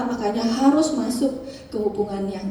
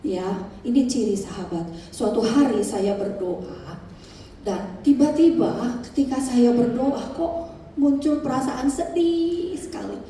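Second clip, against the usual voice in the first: ordinary talk, nothing marked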